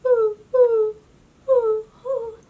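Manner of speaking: high loud hooting owl; high owl hoots. Acted. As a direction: hoot like an owl in the dark.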